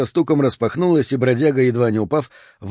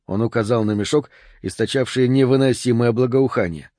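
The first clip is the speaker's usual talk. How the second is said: ordinary delivery, nothing marked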